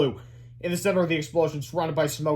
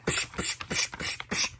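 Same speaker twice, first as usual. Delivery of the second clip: beatbox; 7 dB quieter, slower than usual. Acted. Beatbox between song lines.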